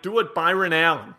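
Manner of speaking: normal talking voice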